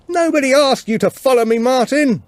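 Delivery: high, loud rebuke over the storm; shouting in the wind. Acted. Tilda scolds Martin for following her, shouting over the wind. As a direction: throw it at him so he hears.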